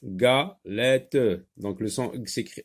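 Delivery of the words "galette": "Galette" as ga, then lette; teacher isolating ga at the start of "Galette"; split into syllables. Shown as a demonstration. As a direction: pause after the first syllable of "Galette". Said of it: "se trouve dans la première syllabe".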